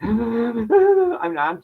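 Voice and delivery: choked gasp. Retold or imitated. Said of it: "I couldn't even talk".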